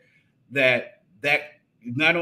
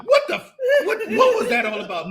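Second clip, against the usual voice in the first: very high laughing mock yell; frustrated outburst; 4 dB louder, much faster than usual. Husband retelling cursing at wife, laughing frustrated yell. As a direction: blurt it out exasperated, half laughing. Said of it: "My frustration level just went off the chain".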